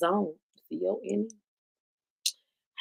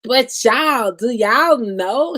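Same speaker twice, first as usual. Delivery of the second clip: louder, slowed, building hype; excited; 3 semitones higher, 8 dB louder, much slower than usual. Real, not acted. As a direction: tease the big news. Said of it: "excited build-up".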